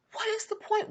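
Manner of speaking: pleading to God, high and pained; hurt, frustrated cry. Retold or imitated. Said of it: "I'm frustrated. I'm angry. I'm hurt".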